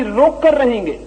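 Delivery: high, insistent mock cry; retold defiant shout. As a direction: imitate someone shouting in stubborn resistance. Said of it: "वो चिल्ला रहा है".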